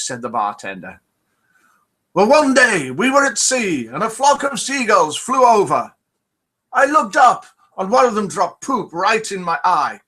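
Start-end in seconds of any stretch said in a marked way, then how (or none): 2.1-10.0 pirate voice pitched much higher, barely piratey; hammed-up pirate that misses the accent; a pirate in a joke, voiced half-heartedly high; failed pirate accent. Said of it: "my pirate accent".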